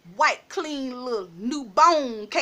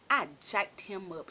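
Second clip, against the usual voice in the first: ordinary delivery, nothing marked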